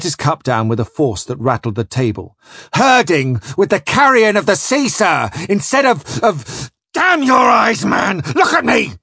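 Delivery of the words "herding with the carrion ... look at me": loud, high-pitched furious outburst; rising rage. A narrator's reading. Disgraced captain raging at Flashman's imagined contempt, furious shouting. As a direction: rant like a captain losing all control. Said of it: "His voice was rising and his scar going crimson".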